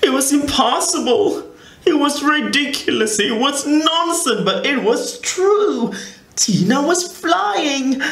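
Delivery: put-on the big reveal read to children, amazed; amazed narration. A narrator's reading. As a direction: tell it like you can't believe it. Climax of the story, amazed.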